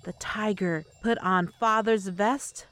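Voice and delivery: ordinary delivery, nothing marked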